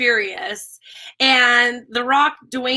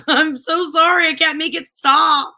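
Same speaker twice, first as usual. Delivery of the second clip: high, slow mock-weepy wail; imitating tearful apology; 5 semitones higher, 3 dB louder than usual. Woman retells apologizing to a guy for her tears, mock tearful. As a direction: stretch it out as a playful imitation of crying. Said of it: "I'm gonna cry".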